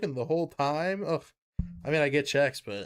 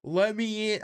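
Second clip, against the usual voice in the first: higher, louder pleading yell; Eric Andre meme; 8 semitones higher, 4 dB louder than usual. Retold or imitated. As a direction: yell to be let in. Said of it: "Eric Andre meme".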